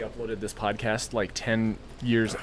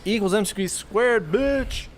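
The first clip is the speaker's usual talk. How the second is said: cocky taunt; 9 semitones higher, slower than usual. Pretend. Mock smug math boast.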